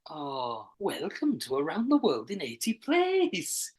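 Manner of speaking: slow, swooping Welsh lilt; Welsh accent. Shown as a demonstration. Performing on a co-host's request, slow Welsh lilt. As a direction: exaggerate the Welsh sing-song, slowly. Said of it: "Do your Welsh accent".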